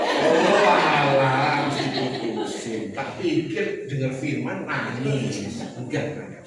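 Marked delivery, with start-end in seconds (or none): 0.0-2.9 amused letdown. Retold or imitated. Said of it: "Saya habis diputusin".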